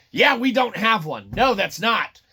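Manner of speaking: ordinary talk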